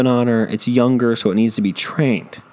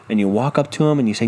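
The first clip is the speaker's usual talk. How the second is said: normal talking voice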